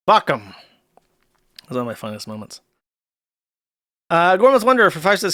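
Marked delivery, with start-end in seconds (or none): none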